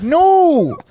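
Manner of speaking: high, loud shouted refusal. Retold or imitated. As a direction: yell it like clutching a treasure. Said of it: "You're like".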